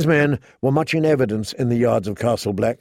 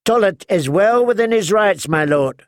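higher, measured formal statement; knight's ruling; 6 semitones higher than usual. A narrator's reading. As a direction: lay down the law calmly. Mallister continues.

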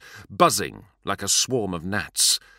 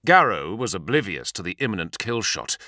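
usual speaking voice

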